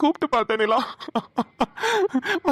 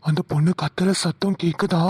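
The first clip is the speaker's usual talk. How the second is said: much lower, husky ghost-story hush; husky spooky voice; 6 semitones lower than usual. Acted. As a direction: spook her with a low eerie question. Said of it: "நீ பேசுற husky voice". (மிகத் தாழ்ந்த கிசுகிசுப்பான பேய்க் குரல்)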